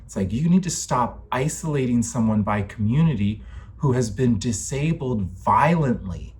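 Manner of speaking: ordinary talk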